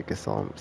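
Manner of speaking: Gysahl as giss-AWL, no SH; plain S and open second vowel in Gysahl; corrected pronunciation. Shown as a demonstration. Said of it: "I guess the S and the H aren't".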